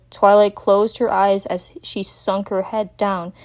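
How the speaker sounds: ordinary delivery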